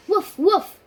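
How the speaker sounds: put-on loud, high childish woof woof; a little girl's loud doggy bark. A narrator's reading. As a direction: bark it out like a happy dog. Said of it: "said Dog".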